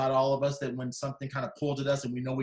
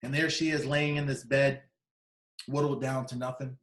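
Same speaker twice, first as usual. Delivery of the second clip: ordinary talk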